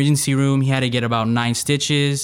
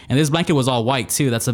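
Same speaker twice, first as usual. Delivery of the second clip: usual speaking voice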